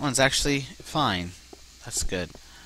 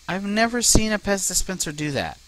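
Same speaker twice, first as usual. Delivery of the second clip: exasperated; 11 semitones higher, 5 dB louder than usual. Real, not acted. Annoyed at lost carts.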